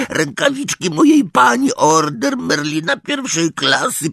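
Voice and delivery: high, slow and near tears; a house-elf listing his losses, voice cracking; distressed elf. A narrator's reading. Elf breaking down over stolen heirlooms.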